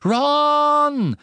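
high, loud held call of the name. A narrator's reading. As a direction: cry out his name in alarm. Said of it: "rief er". (lauter, langgezogener Ruf nach Ron)

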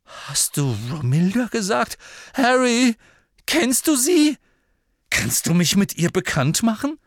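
put-on high, loud infatuated boy; lovesick Ron. A narrator's reading. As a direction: beg high and eager.

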